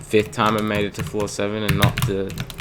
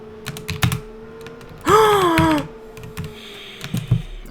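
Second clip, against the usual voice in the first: high breathy gasp of fright; sharp gasp. Real, not acted. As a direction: suck in air in alarm. Sudden danger in game.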